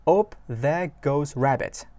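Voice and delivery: drawn-out, careful English line; unhurried teacher read; slow lyric reading. Shown as a demonstration. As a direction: spell it out slowly for the class. Teacher reads lyric slowly.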